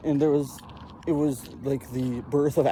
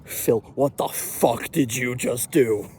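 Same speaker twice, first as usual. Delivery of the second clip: stern mom; 3 semitones lower, slower than usual. Retold or imitated. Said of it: "turned to me".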